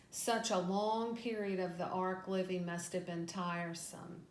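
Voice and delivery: usual speaking voice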